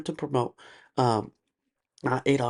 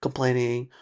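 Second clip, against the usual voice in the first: ordinary talk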